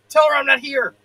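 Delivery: loud, high, rushed plea; hasty shout. Acted. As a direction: shout it in a hurry. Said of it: "hastily shouting".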